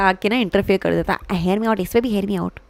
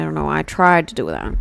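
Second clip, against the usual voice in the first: ordinary delivery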